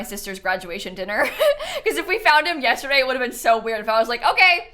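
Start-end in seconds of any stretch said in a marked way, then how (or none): 4.2-4.7 high, loud, jokey sign-off; mock abrupt goodbye. Streamer imagining leaving for a dinner, mock loud goodbye. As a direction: call out a pretend cheery farewell.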